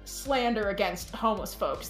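usual speaking voice

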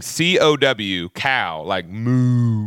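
slow drawn-out Southern cow. Shown as a demonstration. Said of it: "because of our deep Southern accents".

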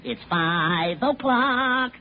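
slow drawn-out time call; slow announcer read. Shown as a demonstration. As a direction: stretch the words slowly. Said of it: "like this".

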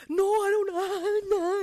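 high, loud, slow whine; mock upset mother; whining mom. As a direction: imitate mom's tantrum. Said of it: "she's like".

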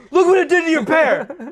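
high, loud Southern mock outrage; hammed-up Southern yell; Southern accent. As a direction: bellow it as an indignant Southern dad. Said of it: "he's becoming more southern".

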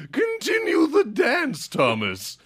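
high, loud and slow command; fancy aristocrat voice. Pretend. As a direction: order it like a pompous lord. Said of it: "He's like".

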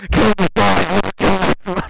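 loud hammed-up wail; mock emo wail, loud; mock Jeff Hardy shout. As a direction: yell it like a tortured emo singer.

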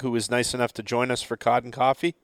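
usual speaking voice

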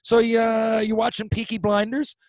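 high, loud dad impression; imitating dad. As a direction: play the father dropping by, high pitched. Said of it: "So he'll pop by".

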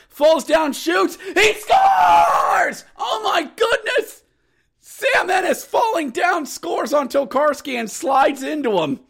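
high, shouted call building to amazement; ecstatic goal call. Retold or imitated. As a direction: scream the goal in disbelief. Calls a shootout goal.

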